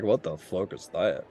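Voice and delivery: low, startled exclamation; shocked. Acted. Shopkeeper seeing a hidden figure revealed, low shocked. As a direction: drop low and gape at it. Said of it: "Embrick's like".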